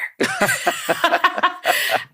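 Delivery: high, bright giggle; light high laugh. Real, not acted. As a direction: laugh at yourself. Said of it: "laughs at own joke".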